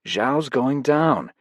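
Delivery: slow satisfied announcement. A narrator's reading. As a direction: savour each word.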